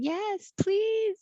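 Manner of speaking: high, drawn-out pleading squeal; imagined eager listeners. Acted. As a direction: beg in a high little voice, playfully. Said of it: "I'm sure our listeners are going".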